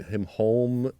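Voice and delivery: normal talking voice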